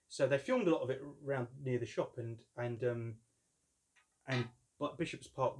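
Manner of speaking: usual speaking voice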